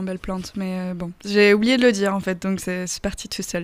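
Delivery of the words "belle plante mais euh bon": "belle plante" murmured much softer and lower; embarrassed mumble. Real, not acted. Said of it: "je trouve ça mignon comme compliment".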